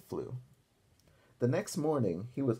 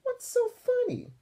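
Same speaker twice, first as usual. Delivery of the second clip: high, slow offended question; indignant D W; 4 dB louder than usual. Acted. D W asking why Arthur laughs, high and indignant. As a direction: demand it like a cross little girl.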